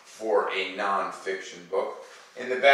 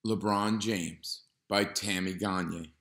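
put-on calm, even read-aloud voice for kids; reading to children. Teacher reading a nonfiction book to students, calm and clear. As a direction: read the page clearly to the class.

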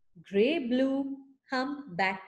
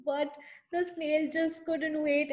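usual speaking voice

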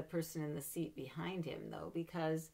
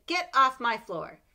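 higher, louder stern mom; scolding mother; 8 semitones higher, 16 dB louder, slower than usual. Acted. Mother scolding her child about duck feet, loud and stern. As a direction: bark it like a cross mother. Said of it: "She would say".

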